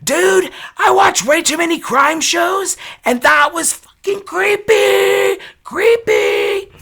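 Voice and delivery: high, loud shout at a stranger; yelling out. Retold or imitated. Shaken wife shouting at the stranger leaving her porch. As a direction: yell it through the door, rattled and defiant. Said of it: "I yelled out".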